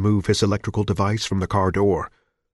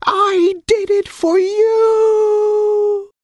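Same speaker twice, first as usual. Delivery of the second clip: high, slow, crying shriek; 6 dB louder, much slower than usual. Acted. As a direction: wail it as a long shriek. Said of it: "scream rose to a shriek".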